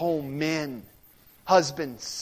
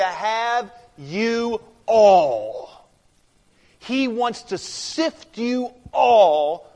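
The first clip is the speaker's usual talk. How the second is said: "you all" stretched out slow and loud; stress on YOU ALL; 3 semitones higher, 8 dB louder, much slower than usual. Shown as a demonstration. Preacher shows the congregation the plural, "you all" drawn out loud. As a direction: lean long and hard on "you all".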